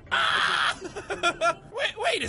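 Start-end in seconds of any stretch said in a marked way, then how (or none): 0.1-0.8 harsh, throaty scream; guttural primal scream. Shown as a demonstration. Gamer replaying his own scare, guttural primal scream. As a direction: demonstrate a raw scream from the throat. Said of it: "guttural primal scream".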